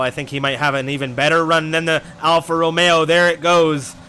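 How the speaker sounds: loud, driving race commentary; half yelling. Real, not acted. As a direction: call the overtake at a half-yell. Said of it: "kinda half yelling".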